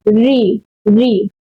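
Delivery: री, री with the tongue where टा is made; carefully repeated retroflex री. Shown as a demonstration. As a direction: demonstrate री, tongue tip up on the palate. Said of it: "तो कैसे बोलेंगे?".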